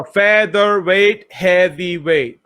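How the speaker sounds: slow, drawn-out syllables. Shown as a demonstration. Repeating a rapper's rhyme to study it, syllable by syllable. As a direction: sound out each syllable slowly.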